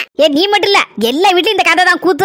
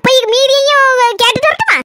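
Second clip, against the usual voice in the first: slow, higher teasing bride voice; sly daughter-in-law; 4 semitones higher, slower than usual. Acted. Daughter-in-law teasing mother-in-law, slow and higher. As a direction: drag it out with fake innocence.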